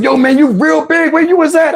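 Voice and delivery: high, excited "where you was at". Retold or imitated. Friends hyping him up, excited shout. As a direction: hype him up like an old friend. Guys greeting him loudly.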